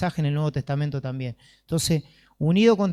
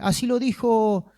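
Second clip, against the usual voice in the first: higher-pitched mock citing of authorities; mimicking name-droppers; 7 semitones higher than usual. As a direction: imitate people quoting thinkers, pitched up. Mimics people who cite philosophers. (imitando con tono burlón, más agudo)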